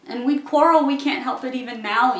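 agitated protest. Acted. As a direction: burst out with exasperated insistence. Arguing why marriage would fail.

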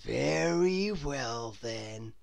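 dreamy slow consent; Mister Daydream voice. A narrator's reading. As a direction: agree slowly and kindly. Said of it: "said Mister Daydream".

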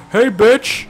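high, loud call-out; shouted taunt. Real, not acted. Gamer spotting an enemy in the water, shouted taunt. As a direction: yell it at an enemy across the water.

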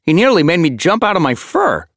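loud, high-pitched irritated complaint; peeved and emphatic; grumbling. A narrator's reading. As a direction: grumble crossly about being startled. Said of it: "Sparrow Pelt grumbled".

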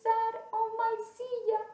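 high, loud mock wail; crying baby bear. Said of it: "started crying and he said".

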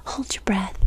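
usual speaking voice

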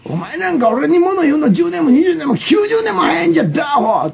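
high-pitched mock tirade; quarrelling. Demonstrating a typical Osaka fight, mock angry tirade. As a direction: act out an Osaka street quarrel. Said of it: "とか言う喧嘩が多いんですよ".

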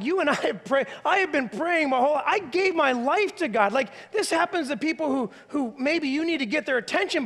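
angry outcry. Retold or imitated. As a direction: complain to God as if betrayed. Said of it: "Then became the anger".

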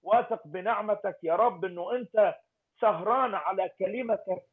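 normal talking voice

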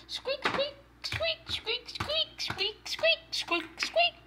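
tiny, high, soft squeaky bunny; very high, quiet bunny squeaking; chocolate bunny squeaks. Pretend. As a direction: squeak softly like a little toy rabbit. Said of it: "jumping around and saying squeak".

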